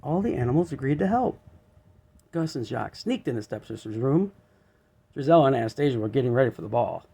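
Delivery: ordinary delivery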